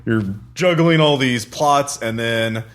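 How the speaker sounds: normal talking voice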